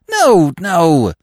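high, loud despairing cry. A narrator's reading. Freddy despairing at Eve's denseness, loud high cry. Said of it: "said Freddy despairingly".

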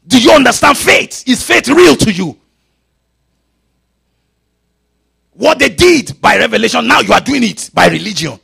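loud, high, challenging preacher; full-force preaching. Acted. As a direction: thunder the questions out at the congregation.

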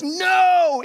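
slow, loud, high drawn-out no; exasperated, upset no; frustrated no. Shown as a demonstration. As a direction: let the anger stretch the no. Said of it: "God is frustrated and upset with you".

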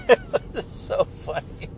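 laughing high through the words; real giggle running into speech; amused laughter. Real, not acted. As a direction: say it while cracking up. Laughing at own imitation of granddaughter.

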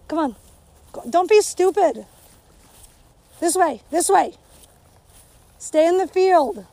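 loud, high calls to the dog; calling the dog. Real, not acted. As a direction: call the dog back across the field.

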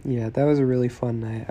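ordinary talk, nothing marked

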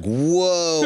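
high, loud drawn-out whoa; amazed. Retold or imitated. Imitating friend amazed by Nebraska.

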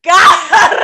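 high, loud burst of laughing; loud laughter. Real, not acted.

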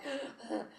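imitated sharp gasps of labor breathing. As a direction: gasp as if breathing through contractions.

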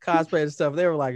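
normal talking voice

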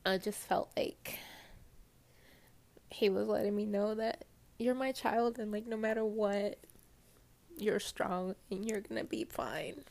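fighting back tears, with long pauses; tearful, halting, pitch creeping up; real choked up. Real, not acted. Remembering God's love after heartbreak, choked up. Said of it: "not gonna get emotional".

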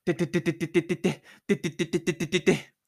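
fast chattering de-de-de; rapid running patter. A narrator's reading. Narrator voicing Tomohiro running out of school, rapid patter. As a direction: rattle it off like pounding feet.

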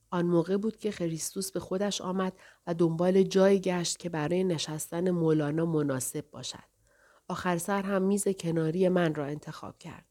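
usual speaking voice

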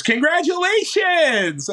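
high, slow, drawn-out mock congratulations; a lightly voiced cheerful caller; cheery congratulations. Player liaison calling with news.

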